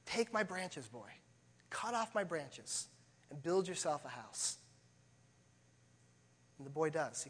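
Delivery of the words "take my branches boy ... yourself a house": soft, slow offering; tree quietly offering its branches to the boy; tender tree. Acted. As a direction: give everything away gently. Self-giving tree voiced in story.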